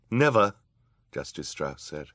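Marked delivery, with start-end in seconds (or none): 0.1-0.5 high, loud refusal; defiant judge. A narrator's reading. Judge refusing the villain's threat, high loud defiance. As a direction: refuse outright, voice raised.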